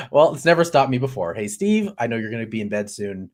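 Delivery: normal talking voice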